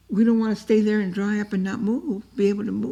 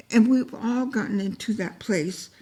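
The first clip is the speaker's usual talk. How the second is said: usual speaking voice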